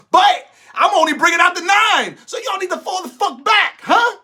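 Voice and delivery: mock shouted apology. Comedian shouting fake apology at critics, loud mock yell. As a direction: yell it like a hammed-up threat. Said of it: "This is my apology".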